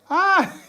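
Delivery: short, high exclamation; high yelp of reaction; high-pitched "ah". Real, not acted. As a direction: let out a sudden high "ah!". Reacting to team going home.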